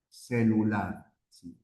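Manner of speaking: ordinary delivery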